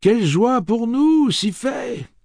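high, loud woman calling her thanks; woman's cry. Acted. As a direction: call out gratefully over the crowd. Woman cries out.

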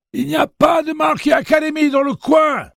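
put-on very high, loud cracked old man; old man's voice. Old man correcting Jake, high loud old voice. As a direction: play a cranky old man.

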